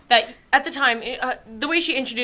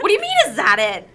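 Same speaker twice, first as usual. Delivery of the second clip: higher, affronted retort; mock indignation; 5 semitones higher, 4 dB louder than usual. Retelling friend's reply to being doubted, mock indignant. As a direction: imitate an affronted comeback.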